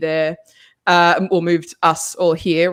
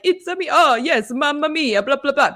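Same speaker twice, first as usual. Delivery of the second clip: high, exaggerated Italian movie accent; mock a cartoonish Sicilian accent, pitched way up; 7 semitones higher than usual. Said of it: "that accent where it's like".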